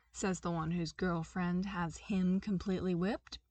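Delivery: ordinary delivery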